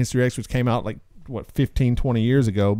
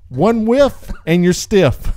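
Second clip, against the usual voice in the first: slow punchline; 3 semitones higher, 3 dB louder, much slower than usual. Acted. Reading punchline with relish.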